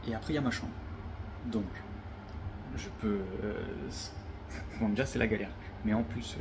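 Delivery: ordinary talk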